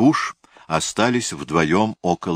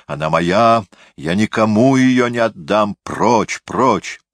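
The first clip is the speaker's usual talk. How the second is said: usual speaking voice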